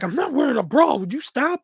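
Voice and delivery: imitating a friend's high, indignant objection; indignant protest. Friend refusing his request in line, indignant mock protest.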